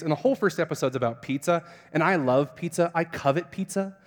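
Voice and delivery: ordinary delivery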